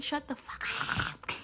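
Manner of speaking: high, quiet strangled outburst; hushed, high demonic disbelief; possessed by a demon. Real, not acted. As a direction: squeeze it out like someone possessed. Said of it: "I just got possessed by a demon".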